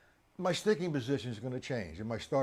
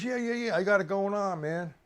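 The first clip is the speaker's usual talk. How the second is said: lightly mocking a cocky student, higher; 7 semitones higher than usual. Teacher imitating his overconfident student. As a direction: toss it off like a know-it-all kid. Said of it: "He goes".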